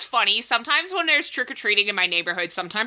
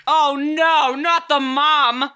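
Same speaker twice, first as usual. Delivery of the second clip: high, loud, drawn-out cry of dread; playful exaggerated fright, louder and slower; mock horror; 7 semitones higher, 5 dB louder, much slower than usual. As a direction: ham up the terror. Mock horror at mom arriving.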